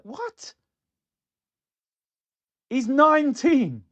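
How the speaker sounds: high-pitched astonishment; shocked disbelief. Real, not acted. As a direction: squeak a very high what then a slow loud he's nineteen. Shock at striker's age.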